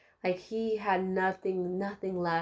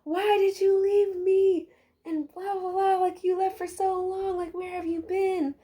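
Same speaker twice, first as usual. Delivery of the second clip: mock whine, much higher; whiny coworkers; 11 semitones higher than usual. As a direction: play the coworker pouting that you left. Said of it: "They were like".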